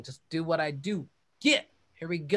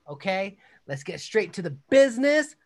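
normal talking voice

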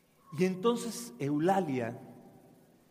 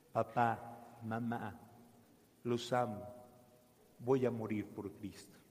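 tearful farewell; 7 semitones lower, 8 dB quieter than usual. A narrator's reading. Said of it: "y empezó a llorar".

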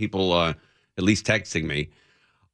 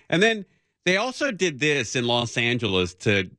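normal talking voice

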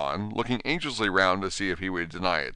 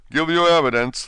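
louder, angry royal command; angrily; 8 semitones higher, 8 dB louder than usual. Acted. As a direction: bark the order like a cross king. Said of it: "the King repeated angrily".

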